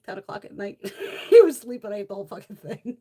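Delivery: normal talking voice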